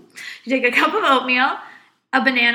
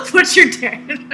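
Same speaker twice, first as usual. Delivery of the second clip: laughing; 4 dB louder than usual. Real, not acted. Repeating her own joke, laughing.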